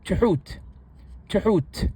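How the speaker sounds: Tuhut said with a clear break after the first t; Tigrinya word demo. Shown as a demonstration. Teacher pronouncing the Tigrinya word for polite. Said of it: "it is spelled as follows".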